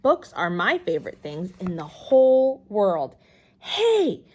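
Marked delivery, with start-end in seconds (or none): 3.6-4.2 high, loud thrilled shout; a kid shouting in awe; excited holler. A narrator's reading. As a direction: burst out with delight. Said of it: "I hollered, feeling very excited".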